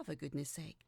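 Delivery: ordinary delivery, nothing marked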